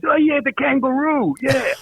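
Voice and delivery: high, put-on voice of a New York waiter; teasing impression of a waiter, pitched high; mocking waiter. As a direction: lift way up into a cheeky mafia waiter's voice. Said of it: "And the guy goes".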